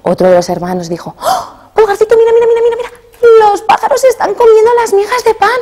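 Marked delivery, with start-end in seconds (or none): 1.2-5.5 put-on very high, urgent child's cry; a brother crying out as birds eat the crumbs; alarmed shout. Brother sees birds eating crumbs.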